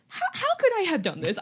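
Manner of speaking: put-on high-pitched, incredulous kid protest; playfully high, put-upon disbelief; mock indignant protest. Accused child protests in retelling.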